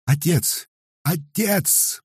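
high, loud panicked calling; desperate cries. A narrator's reading. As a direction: cry out to her father in terror. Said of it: "послышались крики Татьяны".

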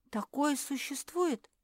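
high, slowed question; surprised. A narrator's reading. Беатриса hearing of rare bird feathers, surprised. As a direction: ask it in drawn-out wonder. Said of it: "удивилась Беатриса".

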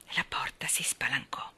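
plain reading voice

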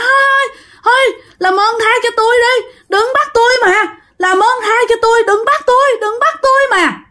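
very high, desperate plea for mercy; crying out, begging. Acted. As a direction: wail it way up high without much added volume. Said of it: "thì nó mới gào lên". (giọng gào van xin, rất cao, hoảng hốt)

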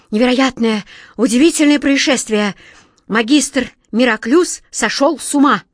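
high, loud, astonished announcement; excited exclamation. A narrator's reading. Courtier reporting incredible news to the king, loudly exclaiming. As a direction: proclaim shocking news with full force. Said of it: "воскликнул".

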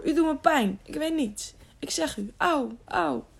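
high, whining complaint of pain; wailing. A narrator's reading. Servant shaken by the knight, wailing. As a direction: whimper and protest innocence. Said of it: "Hij jammerde zo luid".

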